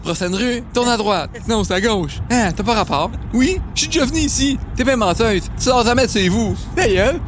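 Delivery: high robotic GPS voice squabbling; bickering sat-nav voices. Pretend. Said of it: "la voix des G P S de Google Maps".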